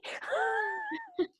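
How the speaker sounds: very high, drawn-out laugh; high squealing laugh. Real, not acted. Host cracks up at own doubting, squealing laugh.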